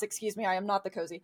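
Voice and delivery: ordinary talk, nothing marked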